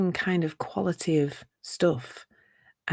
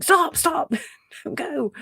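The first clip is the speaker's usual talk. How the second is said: mock shouting; 10 semitones higher, slower than usual. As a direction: imitate the Joker shouting orders. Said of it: "Joker then started shouting".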